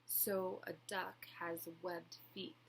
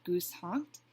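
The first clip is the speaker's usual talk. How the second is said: plain reading voice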